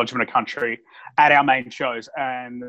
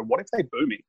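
quiet worried question; 7 semitones higher, 6 dB quieter, faster than usual. Retold or imitated. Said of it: "did quietly come over and go".

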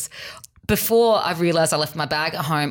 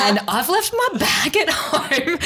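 laughing; 10 semitones higher, slower than usual. Retold or imitated. Retold confession to manager.

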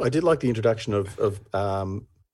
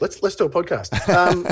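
laughing; 8 semitones higher than usual. Real, not acted.

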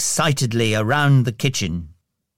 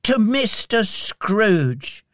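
slow, high-pitched formal toast; solemn toast; 8 semitones higher, much slower than usual. A narrator's reading. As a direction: raise the toast ceremoniously, drawing out each word. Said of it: "they said solemnly".

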